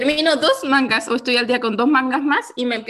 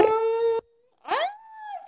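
mock shocked gasp; 13 semitones higher, 4 dB quieter than usual. Real, not acted. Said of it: "se nos puso grosera".

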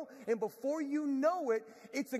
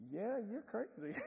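imitated hushed remark; 7 dB quieter than usual. Retold wife beside the burning shoe.

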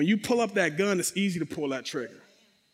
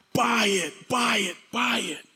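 will them to buy, stretching each word; urging inner voice; 4 semitones higher than usual. Retold or imitated. Young [name] willing the five men to buy, urging.

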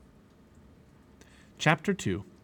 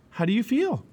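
higher-pitched, lively family question; concerned question; 11 semitones higher, 4 dB louder than usual. A narrator's reading. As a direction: ask with a lift in pitch.

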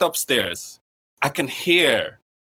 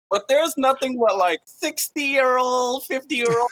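incredulous; 9 semitones higher, slower than usual. Real, not acted. Incredulous about old swingers.

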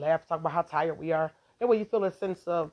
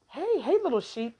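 naive sweet greeting; 9 semitones higher than usual. Retold or imitated. Fooled person greeting a wolf, high cooing hello. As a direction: coo at it like a pet lamb.

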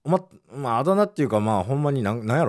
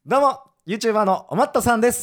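bright channel-opening greeting, a bit higher; cheery on-camera hello as a new YouTuber; YouTuber self-introduction; 7 semitones higher than usual. Pretend. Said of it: "おまっとさんで自己紹介してみて".

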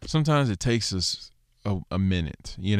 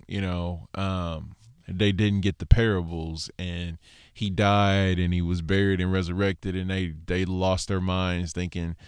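usual speaking voice

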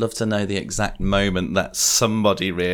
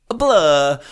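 hammy Italian accent; 5 semitones higher, 5 dB louder than usual. Shown as a demonstration. Pushing the accent more than his co-host, hammy Italian. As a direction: push the Italian accent over the top. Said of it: "My accents are more hammy. I would have gone".

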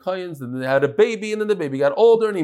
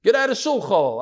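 higher-pitched heckling yell, dropping on the last word; mock yell; 6 semitones higher, slower than usual. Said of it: "When they yelled at him".

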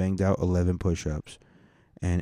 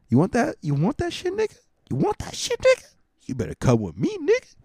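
higher, hammed-up brother voice, rising to squeaky peaks; imitating his brother; 5 semitones higher than usual. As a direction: pester him to come get shape-ups. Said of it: "That's what my brother says to me".

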